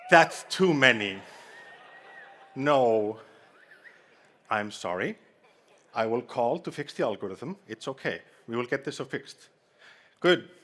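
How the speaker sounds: normal talking voice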